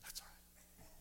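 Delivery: breathy sulking whisper; passive-aggressive whispered aside; mock whisper. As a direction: hiss it under your breath, wounded. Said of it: "he wasn't saying it like".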